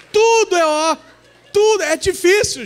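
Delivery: high, loud whiny groan; hammed-up husband moaning that everything is hard; mock complaint. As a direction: whine like a helpless husband. Mocking helpless husbands.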